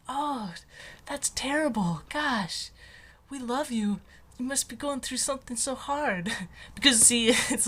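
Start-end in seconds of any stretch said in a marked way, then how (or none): 0.1-6.3 lightly voiced sympathizer, pitched higher; pitying friend. Friends consoling someone about a disease, higher and pitying. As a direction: coo with soft pity, a mild imitation. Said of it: "Would people be like".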